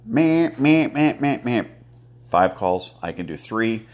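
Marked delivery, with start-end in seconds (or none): 0.0-1.6 loud nasal quacking duck call; duck hail call. Shown as a demonstration. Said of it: "I can do a hail call, which is".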